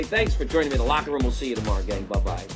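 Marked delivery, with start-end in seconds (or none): none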